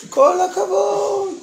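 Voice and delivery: high, slow, ringing praise; hammed-up Hebrew well done, high and drawn out; proud mother's cheer. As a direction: shout out bravo like a proud mom. Mother praises son in story.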